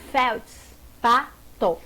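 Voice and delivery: slow feldspato, felds-pa-to with gaps; syllable by syllable. Shown as a demonstration. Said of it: "como separa".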